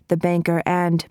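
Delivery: plain reading voice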